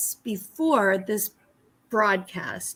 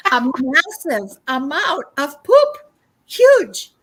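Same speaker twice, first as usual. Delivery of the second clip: higher and louder, building to a cry on huge; telling of the eagle pooping right at her, in amazement; amazed exclamation; 6 semitones higher, 7 dB louder, slower than usual. Real, not acted. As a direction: marvel at it, bursting out. Eagle poops toward her.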